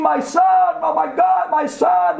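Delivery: high, anguished cry over a son; acted wail of horror; mothers screaming.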